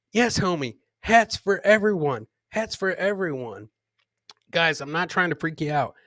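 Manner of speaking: usual speaking voice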